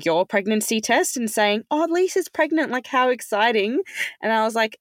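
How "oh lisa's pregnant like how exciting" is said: high, gushing imitation of mum; a lightly hammed-up excited relative; mock excited family. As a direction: play up their excitement, much higher. Mum and sister misreading the news.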